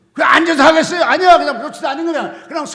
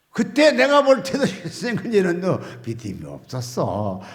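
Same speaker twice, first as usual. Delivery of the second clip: ordinary delivery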